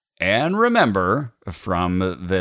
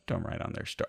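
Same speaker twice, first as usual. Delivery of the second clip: unvoiced quick whisper; whispered aside; 3 semitones lower, 12 dB quieter than usual. Real, not acted. Aside correcting a joke suggestion.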